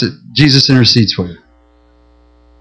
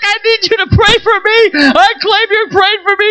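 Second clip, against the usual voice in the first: high, tearful urgent prayer; desperate prayer. Shown as a demonstration. Pastor showing the church how to ask Jesus, crying out. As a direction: plead with Jesus right now. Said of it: "You can go to Jesus".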